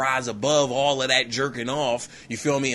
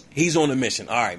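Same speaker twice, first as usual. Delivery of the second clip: normal talking voice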